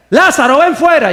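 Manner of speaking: confident shout at the tomb; commanding call. Shown as a demonstration. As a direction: order him out with full faith. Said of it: "creer la palabra de Dios".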